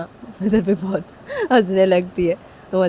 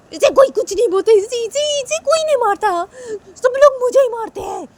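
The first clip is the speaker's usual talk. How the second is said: very high, loud wail of unfairness; acted childish tantrum; aggrieved outburst; 12 semitones higher, 4 dB louder than usual. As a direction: complain that everyone picks on you. Sanju complains only he is hit.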